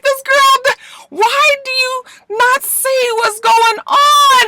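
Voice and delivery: very high, slow, pleading disbelief; high drawn-out exasperation; exasperated. Real, not acted. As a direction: plead with her to wake up. Exasperated at the character.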